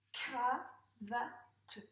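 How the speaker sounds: "Cravate" as cra, va, te, with pauses; syllable by syllable. Shown as a demonstration. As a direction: separate each syllable of "Cravate" for a child. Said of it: "dans quelle syllabe on entend". (« Cravate » découpé syllabe par syllabe)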